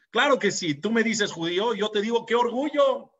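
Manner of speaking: proud retort. Retold or imitated.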